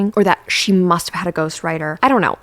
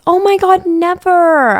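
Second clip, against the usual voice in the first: high, loud gushing protest; mock gushing denial to a friend; over-the-top reassurance; 11 semitones higher, 7 dB louder, much slower than usual. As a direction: gush as if it's unthinkable. Overdone reassurance to suicidal friend.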